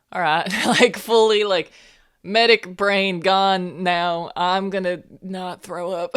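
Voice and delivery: giddy and tipsy, losing it; giggly tipsy. Acted. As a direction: Zrain tipsy, laughing off her medic duty. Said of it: "she's just like".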